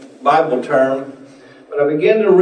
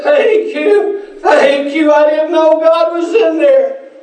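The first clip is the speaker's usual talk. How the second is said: put-on overjoyed old man; 10 semitones higher, slower than usual. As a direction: cry out thanks, then slow down in wonder. Homeless man voiced in story.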